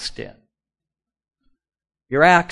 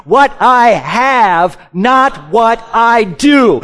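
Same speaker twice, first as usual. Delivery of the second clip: slow, stressing "I have" against "not what I do"; preacher leads the congregation in a slow, emphatic refrain; slow call to repeat; 7 semitones higher, 12 dB louder, much slower than usual. Shown as a demonstration. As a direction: hit "not", hold each word so they can follow. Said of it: "Say this with me".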